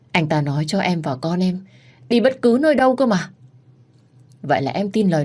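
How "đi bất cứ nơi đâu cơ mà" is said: high, insistent protest; rising plea. Acted. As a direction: push the pitch up in protest. Wife pleading, argument. (giọng phản đối cao vút)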